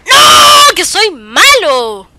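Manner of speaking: loud, dragged-out yell at the game, slow; real gamer rage shout; frustrated shout. Real, not acted. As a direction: yell it out, drawing out soy. Losing a round in game.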